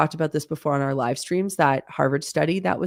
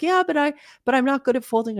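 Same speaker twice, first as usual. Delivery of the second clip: put-on higher-pitched protesting kid; imitated young daughter; 9 semitones higher, faster than usual. Mother quoting her daughter about folding laundry, imitated kid voice. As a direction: voice a child's objection, pitched up. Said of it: "And she's like".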